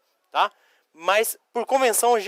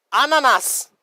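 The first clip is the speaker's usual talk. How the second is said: "ananás" raised, with stress on the final "nás"; stress on last A; 6 semitones higher, 4 dB louder, slower than usual. Shown as a demonstration. Teacher gives students the accented word, "ananás" stressed at the end. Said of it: "Acento no último A".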